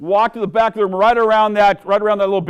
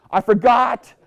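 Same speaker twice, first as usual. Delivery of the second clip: light mock excuse, higher; sheepish Bob; 7 semitones higher, 5 dB louder, slower than usual. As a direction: play Bob shrugging it off. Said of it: "And Bob says".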